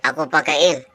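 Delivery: low sly prankster; low scheming friend. Acted. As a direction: dress up the ghost prank gleefully. Friend dresses Doraemon as ghost.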